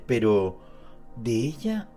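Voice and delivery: normal talking voice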